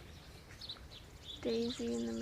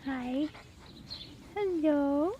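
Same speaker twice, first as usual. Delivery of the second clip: louder sing-song puppy greeting; squeaky praising a dog; 7 dB louder than usual. Real, not acted.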